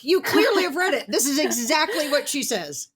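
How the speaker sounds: thrilled you clearly read her book; excited praise. Real, not acted. Host excitedly praises guest's knowledge.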